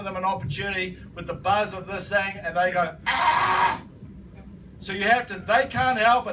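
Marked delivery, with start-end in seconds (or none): 3.1-3.8 high startled cry; mock scream. Negative people hear the offer, high mock scream. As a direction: recoil with a high comic "ah".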